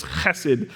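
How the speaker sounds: chesed with a throat-clearing ch at the start; guttural chesed. Shown as a demonstration. Pastor teaching the Hebrew word, guttural. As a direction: rasp the ch in chesed from the throat, stress the first part. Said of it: "kinda guttural and deep".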